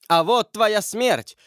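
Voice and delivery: high, slow cry of vengeance; vengeful shout. A narrator's reading. Mother shouts from her window at the killer, crying out. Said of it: "крикнула мать из своего окна".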